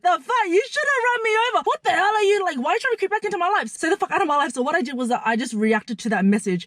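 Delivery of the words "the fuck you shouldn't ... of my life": high-pitched indignant retort; retold indignation. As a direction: tell off an ex in a high, incredulous voice. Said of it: "I was like".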